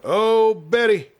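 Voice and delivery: high, slow, drawn-out call; long ringing shout of her name. A narrator's reading. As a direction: call out to her across the yard. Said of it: "he shouted".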